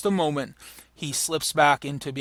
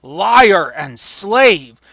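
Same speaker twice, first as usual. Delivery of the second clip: loud, very slow furious line; devastated outburst; 2 semitones higher, 9 dB louder, much slower than usual. Acted. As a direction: hurl it at the messenger. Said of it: "Macbeth is just devastated".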